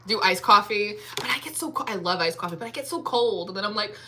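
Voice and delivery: normal talking voice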